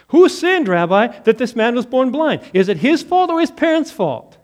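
questioning disciples. Acted. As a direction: fire the question like eager disciples.

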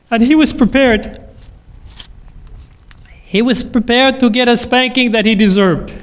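usual speaking voice

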